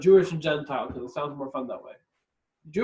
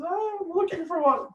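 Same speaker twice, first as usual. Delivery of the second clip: high eager put-on voice, far from his own; hammed-up high innocent voice; high put-on gentile voice; 12 semitones higher than usual. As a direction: play the wide-eyed gentile. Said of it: "So he says".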